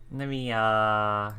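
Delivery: ordinary talk, nothing marked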